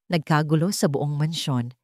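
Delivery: plain reading voice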